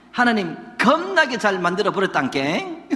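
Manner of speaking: hammed-up Jeolla dialect. Shown as a demonstration. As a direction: ham up the Jeolla sentence ending. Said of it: "전라도 영어는 끝에 깽깽만이야".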